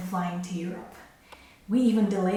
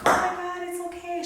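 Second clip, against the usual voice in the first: sweet ticket agent; 9 semitones higher than usual. Retold or imitated. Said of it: "And she was like".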